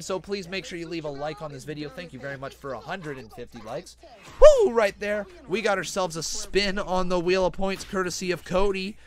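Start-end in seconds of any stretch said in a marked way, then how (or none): 4.4-4.7 loud, high "woo"; excited whoop. Real, not acted. Commentator cheering a move right there, excited whoop. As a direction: let out a sudden high cheer.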